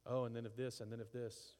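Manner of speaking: soft fretful trailing mutter; a hint of anxious rumination; worried murmur. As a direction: spiral into worry under your breath.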